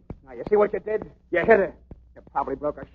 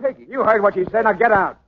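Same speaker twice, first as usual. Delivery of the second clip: angry order; 6 semitones higher, 5 dB louder than usual. Acted. Murray orders Danny out.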